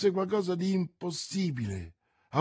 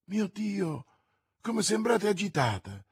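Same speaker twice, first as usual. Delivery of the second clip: high-pitched worried exclamation; alarmed concern; 6 semitones higher than usual. Acted. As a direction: react with anxious surprise. Bonacieux alarmed at Milady's agitation.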